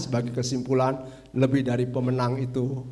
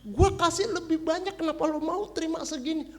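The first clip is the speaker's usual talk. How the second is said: much higher, squeaky mock boss voice; imitating the foreign boss; 13 semitones higher than usual. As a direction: play the boss as a high, indignant foreigner. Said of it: "dia bilang".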